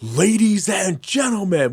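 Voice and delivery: high, slow, drawn-out ring announcer call; WWE-style announcer. Retold or imitated. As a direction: announce it like a hammed-up wrestling MC. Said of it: "like a W W E style stupid thing".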